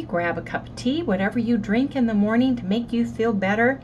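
usual speaking voice

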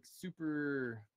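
usual speaking voice

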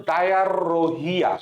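ডায়ারোহিয়া slowly as "daya-rrro-hiya"; repeat-after-me pronunciation. Shown as a demonstration. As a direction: roll out the double r in ডায়ারোহিয়া. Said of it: "আমার সাথে উচ্চারণ করো".

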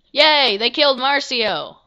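gleeful cheer. Real, not acted. Player cheering that Marcio was killed, loud glee. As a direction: cheer the news like a win.

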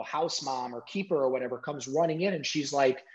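normal talking voice

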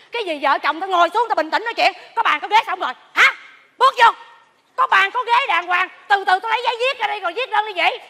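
officious comic scolding; bossy mediating. Acted. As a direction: take charge loudly over a squabbling couple. Mediator takes over the couple's quarrel.

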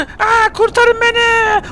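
high, slow drawn-out cry for help; desperate plea. Acted. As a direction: wail it out as if being dragged away. Begging for rescue in tornado. (yüksek ve yavaş, çaresiz yardım çağrısı)

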